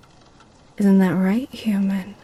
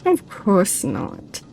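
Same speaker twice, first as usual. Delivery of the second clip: ordinary delivery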